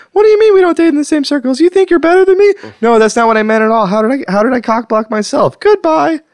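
high, loud, bubbly woman voice; high-pitched dater voice. Pretend. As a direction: squeal it as a chatty speed dater. New speed date character.

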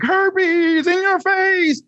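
high, slow, hammed-up horror-movie warning; mock scare tactic. Mocking sex ed scare tactics to friends, high and slow. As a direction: draw it out like a cheesy scare ad.

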